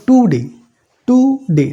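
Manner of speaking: "টুডে" split into two clear beats, টু then ডে; syllable by syllable. Shown as a demonstration. Teacher showing students two tongue movements, "টুডে" in two syllables. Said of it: "দেখো জিভটা একবার ওপরের দিকে touch করছে".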